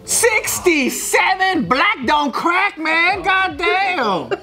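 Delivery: drawn-out, high-pitched astonishment; amazed. Real, not acted. Friend reacting to the actor's age, amazed. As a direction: stretch each word in high, incredulous wonder.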